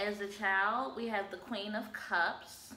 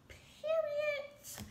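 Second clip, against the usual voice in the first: high squeal. Acted. Emphatic tag word.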